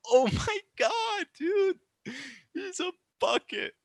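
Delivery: very high, held-back squeal of amazement; awestruck disbelief. Real, not acted. Marvelling at the shot.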